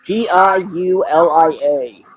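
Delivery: slow, letter-by-letter spelling; spelled out. Shown as a demonstration. Co-host correcting James on a name, slow spelling. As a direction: spell it out slowly, each letter apart.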